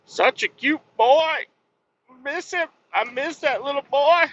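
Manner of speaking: put-on a dad cooing over old videos of his son; doting baby talk. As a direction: coo like a proud dad over baby photos. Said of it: "I was like".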